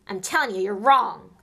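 loud heated rebuke; hotly. Acted. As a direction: snap back in anger. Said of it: "said Hagrid hotly".

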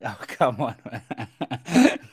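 amused, mock-offended complaint; laughing protest. Real, not acted. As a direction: object laughingly to a friend's teasing. Mock protest after being teased.

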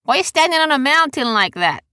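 high, loud call from a ship; brash loud ship hand. Acted. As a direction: holler down at strangers.

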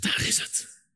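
hushed whisper. Acted. Preacher whispering the son's words at the knock. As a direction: breathe it out, no voice.

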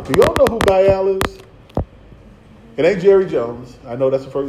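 normal talking voice

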